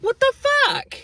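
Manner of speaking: squeaky high what the fuck; disbelief. Real, not acted. Complaining to viewers about being snubbed, stunned high squeak. Incredulous outburst about coworkers.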